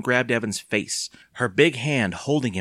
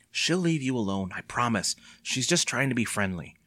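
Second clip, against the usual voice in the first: ordinary delivery, nothing marked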